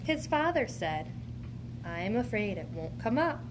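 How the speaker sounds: normal talking voice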